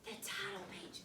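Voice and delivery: soft unvoiced whisper repeating the answer. Real, not acted. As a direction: breathe the words, no voice. Teacher repeats child's answer softly.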